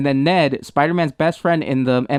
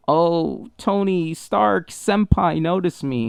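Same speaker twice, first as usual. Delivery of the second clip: slow, drawn-out pining plea; mock fawning; slower than usual. Fan mocking a hero who begs his mentor's notice, mock fawning. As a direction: moon over an idol mockingly.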